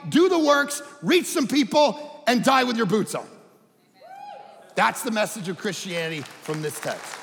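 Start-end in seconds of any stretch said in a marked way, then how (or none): 0.1-3.3 loud, urgent rallying charge; rally them like a coach; Jesus exhorting. Retold or imitated.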